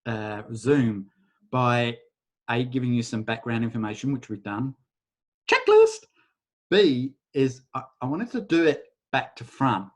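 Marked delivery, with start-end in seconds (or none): 5.5-6.1 much higher, louder burst; bright mock-triumphant cry; excited exclamation. Real, not acted. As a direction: pop it out like ticking a box. Ticking off item, sudden exclaim.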